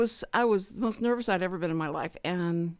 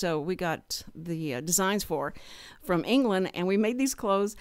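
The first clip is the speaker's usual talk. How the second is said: usual speaking voice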